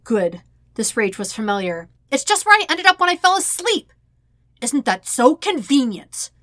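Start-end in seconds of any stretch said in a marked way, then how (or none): 2.1-3.9 much higher, loud defensive yell; exasperated shouted excuse; angry protest. Acted. Sokka's heated argument continues. 4.6-6.3 slow, louder, higher biting sarcasm; scornful spat-out question; sarcastic demand. Acted. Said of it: "Zuko demands, spitting the words out".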